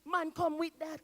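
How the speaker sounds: ordinary delivery, nothing marked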